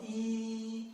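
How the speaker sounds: first tone on E. Shown as a demonstration. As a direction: hold E flat. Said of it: "First tone".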